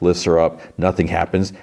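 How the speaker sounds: ordinary talk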